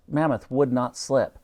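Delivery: ordinary delivery, nothing marked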